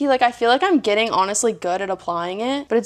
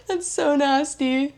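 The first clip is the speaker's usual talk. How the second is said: high giggle into slow, higher words; grossed-out laughing; 5 semitones higher, slower than usual. Real, not acted. Vlogger looking at used wax strips, laughing in disgust.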